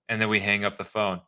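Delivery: usual speaking voice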